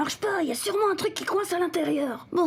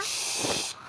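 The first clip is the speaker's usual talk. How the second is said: soft, whispered shushing; hushed shush; 6 dB quieter than usual. Acted. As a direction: hush a sleepy accomplice. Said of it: "Pourquoi tu parles tout bas?".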